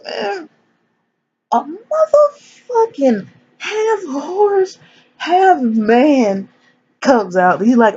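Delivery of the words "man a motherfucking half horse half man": very high, slow, drawn-out reveal; incredulous reveal. Retold or imitated. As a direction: stretch it out in high-pitched disbelief. Big reveal of the creature.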